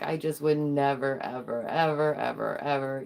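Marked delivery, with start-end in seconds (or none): none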